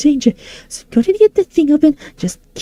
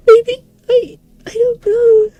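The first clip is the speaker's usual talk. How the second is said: tearful; 7 semitones higher, 5 dB louder, slower than usual. Acted. Despair while shrinking.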